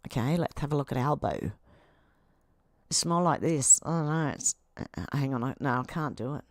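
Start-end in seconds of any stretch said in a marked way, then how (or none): 2.9-6.4 talking through clenched teeth. Shown as a demonstration. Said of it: "talk like this, with his teeth clenched".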